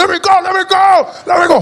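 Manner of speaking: high, loud fake struggle; mock shout. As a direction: pretend to fight free of friends. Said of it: "I was like".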